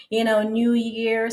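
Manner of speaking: ordinary talk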